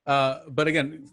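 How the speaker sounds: ordinary delivery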